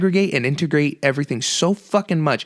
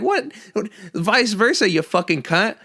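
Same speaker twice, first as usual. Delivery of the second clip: high, incredulous snap back; a retold "are you kidding me" burst, higher pitched; higher pitched outraged comeback to a friend; mock outraged disbelief; 8 semitones higher than usual.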